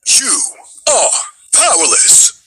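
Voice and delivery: menacing villain line, drawn out; a hint of a menacing robot; villain alt voice. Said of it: "remember your alt".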